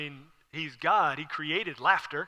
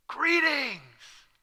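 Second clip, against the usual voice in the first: high, drawn-out happy hail; long, sliding, delighted hello; excited greeting; 8 semitones higher than usual. Acted. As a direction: call it out, bursting with anticipation. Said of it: "you're so excited".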